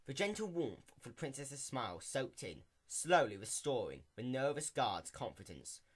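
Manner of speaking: usual speaking voice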